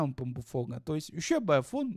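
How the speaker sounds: usual speaking voice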